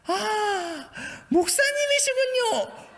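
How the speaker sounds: slow, high, booming Jeolla auntie; Jeolla accent. Retold or imitated. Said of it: "억양이 왜 이렇게". (높고 느린 쩌렁쩌렁한 전라도 사투리)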